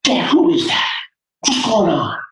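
put-on high young boy's voice; scared boy. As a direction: ask like a frightened kid. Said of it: "Boy turns to his dad and says".

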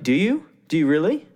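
high, doubting push-back; skeptical challenge. Retold or imitated. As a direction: call their bluff with mock disbelief. Challenging a preacher's claim.

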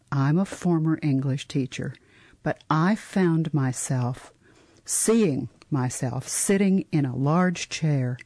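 ordinary talk

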